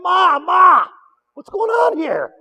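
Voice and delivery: put-on high, loud child's call to his mother; startled little boy. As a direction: call out like a scared kid.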